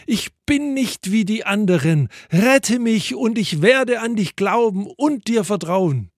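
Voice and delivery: high, eager storyteller's plea; narrated hopeful appeal, pitched high. A narrator's reading. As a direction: plead upward with new hope. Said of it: "nun etwas hoffnungsvoller geworden".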